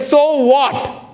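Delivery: high, puzzled retort to the barista; retold so what. Speaker baffled by the barista's pitch, retorting high. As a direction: toss it back, high and baffled. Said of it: "I said".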